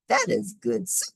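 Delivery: put-on very high old lady voice, amazed. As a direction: pipe it up like a wondering granny. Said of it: "she said".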